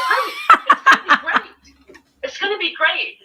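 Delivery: high, loud mock husband cheer; husband's catchphrase. Wife mocking her husband's constant promise, hammed up and loud. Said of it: "he'd always say".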